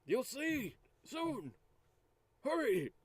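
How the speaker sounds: high, slow, drawn-out character voice; urging character. Acted. Player hurries companion along, slow urging character voice.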